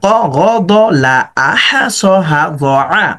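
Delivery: slow, joined in one breath; one breath reading. Shown as a demonstration. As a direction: read the whole line in one breath. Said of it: "এক নিঃশ্বাসে পড়ার চেষ্টা করেন এইভাবে". (পুরো line এক নিঃশ্বাসে)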